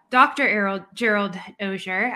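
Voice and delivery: ordinary delivery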